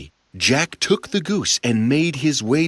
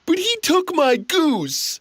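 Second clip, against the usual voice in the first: whiny giant. Acted. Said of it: "cried the giant".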